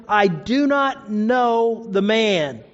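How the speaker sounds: very slow, word by word; slow, deliberate acted denial; emphatic sworn denial. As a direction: draw out each word of the oath. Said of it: "And he swore again".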